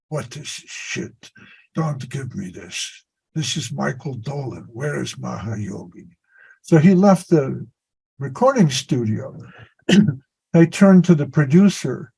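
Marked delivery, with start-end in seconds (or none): 0.1-6.1 whispered mock reproach; retold whispered scolding. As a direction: scold under your breath. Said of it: "He goes".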